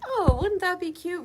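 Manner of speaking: excited. Retold or imitated. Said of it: "I'm like".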